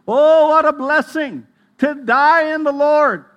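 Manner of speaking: loud, high, slow ecstatic cry; a preacher's rapturous outcry; fervent preaching. Acted. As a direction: lift it up to the rafters, slow and full. Preacher's exclamation of blessing.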